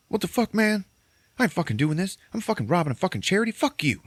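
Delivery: hammed-up high-pitched refusal, fuming; high mock angry rant. Retelling Billy Bob's refusal to rob a charity, high mock angry rant.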